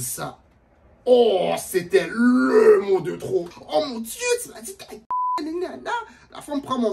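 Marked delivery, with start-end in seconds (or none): 1.0-3.5 loud, slow comic wail; mock wail. Real, not acted. Comedian wailing about the last straw, loud and slow. 3.7-5.9 put-on high, hushed shocked girlfriend; mocking the girl. Storyteller imitating the girl's shocked reaction.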